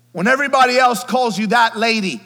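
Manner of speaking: loud, high preaching build; full-force preaching. Acted. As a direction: lift the congregation up, loud.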